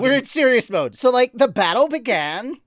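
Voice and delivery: high, loud put-on voice; character voice. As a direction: ham it up in the character voice. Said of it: "I know I'm doing this voice".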